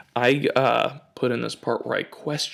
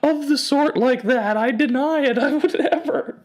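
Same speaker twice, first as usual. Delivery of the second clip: high, loud indignant denial; distraught lady; 8 dB louder than usual. Retold or imitated. Accused lady denying she's a drunk librarian, high and loud. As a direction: protest your innocence in shock. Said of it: "She's like".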